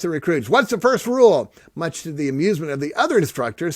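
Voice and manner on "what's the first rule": high, loud barked question; drill instructor question. Retold or imitated. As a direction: demand the answer like a drill sergeant. Instructor quizzes recruits.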